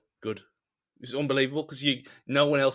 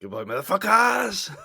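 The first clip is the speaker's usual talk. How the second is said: shouted goodbye; 7 semitones higher than usual. Real, not acted. Cheeky sign-off shout.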